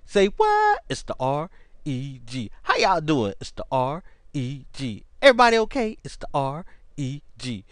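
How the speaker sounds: hyped host greeting the crowd; playful host hype intro; show host intro. Acted. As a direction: sell the show opening like a host. Host opens his show.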